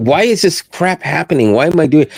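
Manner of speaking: exasperated "why me" retelling; retold exasperation. Retelling own confused reaction.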